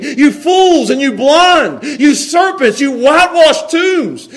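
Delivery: high, slow, shouted denunciation; harsh, strong voice. Retold or imitated. Preacher reads Jesus's insults to hypocrites, harsh and condemning. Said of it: "the harsh voice, the, the strong voice".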